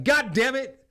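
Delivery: loud, high angry bellow at a private; sergeant yelling. Retold or imitated. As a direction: chew him out like a furious sergeant. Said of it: "and he's like".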